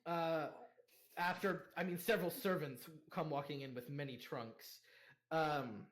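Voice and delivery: ordinary talk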